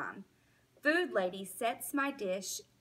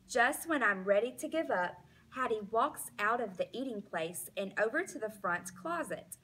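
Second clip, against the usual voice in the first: plain reading voice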